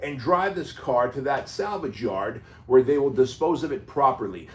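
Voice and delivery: mock politeness, pushed hard and loud; the Wolf's sarcasm. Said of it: "he says".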